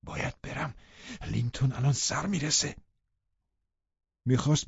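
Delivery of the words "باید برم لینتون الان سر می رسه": hushed, anxious whisper; soft, panicky urgency; frightened. A narrator's reading. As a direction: whisper urgently as if about to be caught. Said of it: "هراسان گفت".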